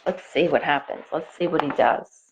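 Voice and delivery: ordinary delivery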